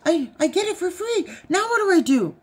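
put-on high, pleading kid voice; excited husband. Wife quotes husband demanding help, high whiny. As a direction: whine it like an eager boy. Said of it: "He's like".

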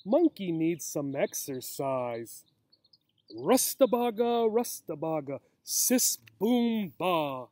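lively sing-song picture-book read; reading to children. A narrator's reading. Teacher reading a picture book to young children outdoors, lively sing-song. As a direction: read it out like story time for little ones.

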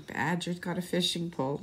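ordinary delivery, nothing marked

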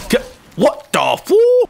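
high, loud, drawn-out shock; startled cry. Real, not acted. Streamer gets suddenly killed, slow loud startled cry.

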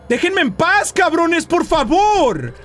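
high-pitched wailing plea, last words drawn out; desperate pleading. Real, not acted. Player begging the ghosts to leave them, desperate pleading. Begs ghosts in Spanish, scared.

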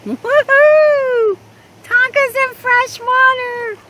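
high, loud woohoo; excited cheer. Real, not acted. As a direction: cheer with delight. Cheering the dog.

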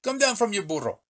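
high, commanding call from the bandit. Acted. Bandit orders the old man off his burro, high call. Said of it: "Pancho Villa called out to me".

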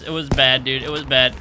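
usual speaking voice

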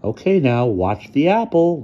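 high, loud, drawn-out call; excited showman's cry. A narrator's reading. Ludwig shows off his apple trick, crying out. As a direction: cry it out like a boy magician. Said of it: "Ludwig cried".

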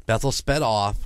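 normal talking voice

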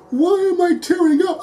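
high, choked-up incredulity; baffled tearful question. Retold or imitated. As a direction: ask it like you can't believe you're welling up. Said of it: "And I'm like".

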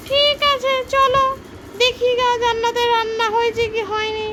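squeaky complaining doll; high doll voice. Pretend. Toy friend agrees. (উঁচু পুতুলের গলা)

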